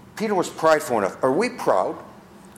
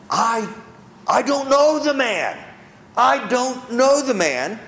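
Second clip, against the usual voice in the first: Peter denying in panic; 8 semitones higher, slower than usual. Acted. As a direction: blurt it out like a cornered man. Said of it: "Peter three times does what".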